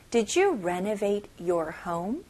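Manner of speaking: ordinary talk, nothing marked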